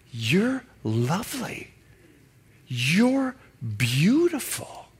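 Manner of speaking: soft, slow and tender; tender compliment. Retold or imitated. Husband praising his wife in Song of Songs, soft and slow. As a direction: breathe it like a lover. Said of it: "And he tells her".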